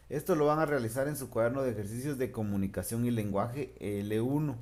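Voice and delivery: usual speaking voice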